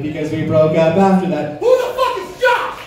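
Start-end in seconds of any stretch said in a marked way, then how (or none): 1.6-2.8 mock outraged squeal. Retold snooping discovery.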